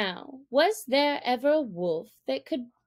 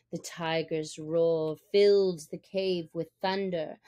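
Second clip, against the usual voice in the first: usual speaking voice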